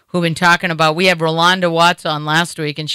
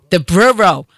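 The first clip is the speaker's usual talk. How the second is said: "Brero" said louder in Boston accent; 5 dB louder than usual. Shown as a demonstration. Host retries the word, blaming his Boston accent. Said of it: "my Boston accent".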